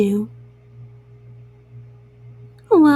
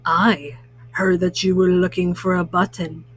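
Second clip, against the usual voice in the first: low-pitched raccoon character; 6 semitones lower than usual. A narrator's reading. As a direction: play the raccoon well below your usual pitch. Raccoon character speaks in story.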